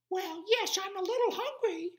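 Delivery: very high, loud squeak; mouse voice. Acted. Tiny mouse agreeing to see the lion, squeaky.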